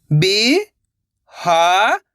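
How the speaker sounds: very slow, stretched syllables, rising at the end; sounding out syllables. Shown as a demonstration. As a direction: sound it out slowly, letter by letter. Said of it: "एक एक अक्षर का उच्चारण करके".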